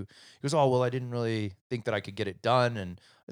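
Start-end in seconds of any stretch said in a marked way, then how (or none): 0.5-2.8 higher, louder mock excuse; defensive kid's excuse. Son explaining missed homework to dad, mildly defensive. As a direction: protest like a kid caught out.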